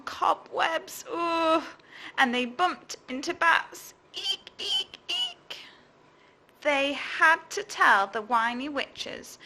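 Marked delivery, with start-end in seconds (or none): 4.1-5.6 very high squeaky eek; bat squeaks. A narrator's reading. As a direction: squeak like tiny bats. Bats squeak.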